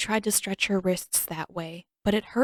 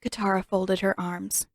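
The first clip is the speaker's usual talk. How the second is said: ordinary delivery, nothing marked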